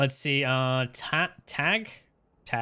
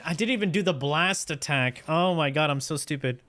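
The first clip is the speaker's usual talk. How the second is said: normal talking voice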